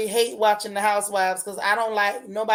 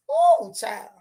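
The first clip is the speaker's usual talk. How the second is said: high theatrical sigh; dramatic sigh; 10 semitones higher than usual. Real, not acted. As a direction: sigh as if overwhelmed. Overwhelmed by juicy gossip.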